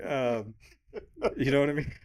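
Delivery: ordinary talk, nothing marked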